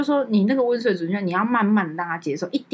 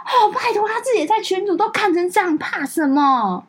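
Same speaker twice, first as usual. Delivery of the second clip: much higher, dragged-out scoff; scoffing sister; 11 semitones higher than usual. Retold or imitated. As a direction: sister brushing off the brother's fear, high and scoffing. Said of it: "他就说".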